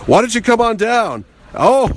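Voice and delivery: high, jovial mock invitation; Adam West. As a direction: imitate a hearty phone invite. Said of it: "He goes".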